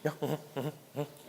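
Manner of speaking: soft murmured phone replies; hushed, mumbled acknowledgements; imitated phone call. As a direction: murmur along as if half-listening on a call. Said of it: "She's doing it in Danish".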